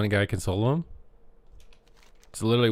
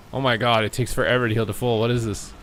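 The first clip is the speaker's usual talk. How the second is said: normal talking voice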